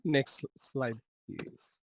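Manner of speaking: quiet aside. Real, not acted. Aside to the slide operator.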